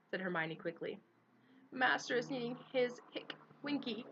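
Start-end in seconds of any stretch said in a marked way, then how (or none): 1.7-4.0 put-on higher, whimpering drunk elf; pleading through hiccups. A narrator's reading. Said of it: "whimpered the elf".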